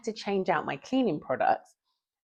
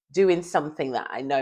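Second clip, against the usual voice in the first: ordinary talk, nothing marked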